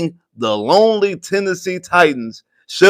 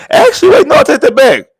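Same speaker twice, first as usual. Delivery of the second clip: sudden correction; 10 semitones higher, 5 dB louder than usual. Real, not acted. Catching his own mistake.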